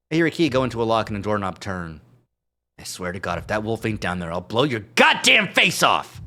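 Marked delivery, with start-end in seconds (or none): 2.8-6.2 threatening weasel. Acted. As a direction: build to a yelled threat. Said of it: "Duke's voice".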